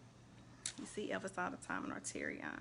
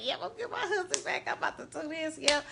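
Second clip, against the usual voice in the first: much higher and fast, fired up with conviction; hyped-up church lady; 7 semitones higher, 7 dB louder, faster than usual. Retold or imitated. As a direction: belt it like you're sure he's coming home. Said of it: "I was like".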